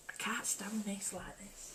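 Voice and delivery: grumbling to herself in a whisper; hushed aside; muttered whisper. Real, not acted. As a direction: whisper it under your breath, slowly. Fussing with glasses on camera.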